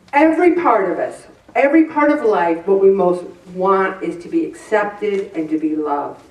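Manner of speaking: ordinary talk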